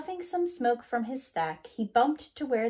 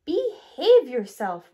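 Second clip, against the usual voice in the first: slow, much louder stern scolding; stern rattle; 5 semitones higher, 11 dB louder, slower than usual. A narrator's reading. Scraper sternly scolding bulldozer. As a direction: tell the kid off. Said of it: "rattled Scraper".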